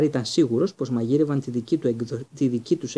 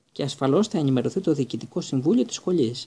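ordinary delivery

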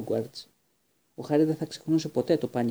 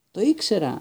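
high, louder cry of triumph; joyful exclamation; 7 semitones higher, 5 dB louder than usual. Acted. Stan realising who Neville is, joyful high cry. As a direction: crow it out, delighted. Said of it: "αναφώνησε χαρούμενα".

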